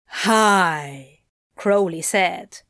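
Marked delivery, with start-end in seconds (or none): 0.1-1.2 drawn-out hi. Acted. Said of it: "drawing out the vowel".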